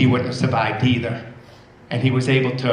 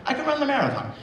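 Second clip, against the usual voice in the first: put-on much higher, delighted child's echo; thrilled little girl repeating the news; excited girl; 11 semitones higher, 4 dB quieter, faster than usual. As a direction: she can't believe her luck, say it high.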